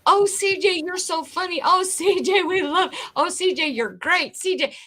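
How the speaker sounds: high-pitched gushing imitation; mock fawning fans. Chat followers flattering C J for weeks, mock gushing. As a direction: gush mockingly like a sucked-in fan.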